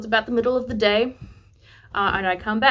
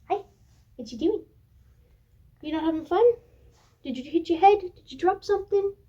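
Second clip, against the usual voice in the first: gentle parent talk; 7 semitones higher, faster than usual. Real, not acted. Parent checking on their daughter playing nearby, gentle and high-pitched. Chatting to small child mid-recording.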